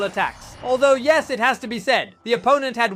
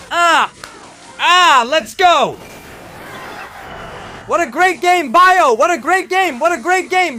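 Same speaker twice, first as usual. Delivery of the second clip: real victory cheer; 5 semitones higher than usual. Real, not acted. Streamer celebrating a hard-won match, ecstatic shouting. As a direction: explode with joy at the win.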